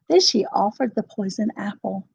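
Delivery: ordinary delivery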